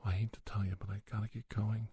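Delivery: usual speaking voice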